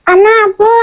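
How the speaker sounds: seperti memanggil orang. Acted. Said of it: "Kayak manggil orang".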